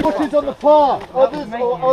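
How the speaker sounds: shouting. Real, not acted. Calling out in field.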